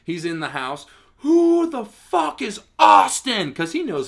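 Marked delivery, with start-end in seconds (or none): 1.2-3.5 high, loud, slow furious demand; screaming owner. Retold or imitated. Owner storming into the house, slow high furious yell. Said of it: "he's screaming".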